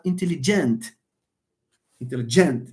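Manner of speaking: "intelligent" as in-te-li-GENT; "intelligent" with the weight thrown onto GENT; stress on last syllable. Shown as a demonstration. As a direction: punch the final GENT of "intelligent". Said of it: "another third person comes and stresses this".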